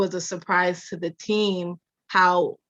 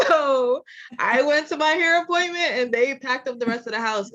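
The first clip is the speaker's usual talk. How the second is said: higher, laughing words; 7 semitones higher than usual. Real, not acted. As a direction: talk through leftover laughter. Laughing through the story.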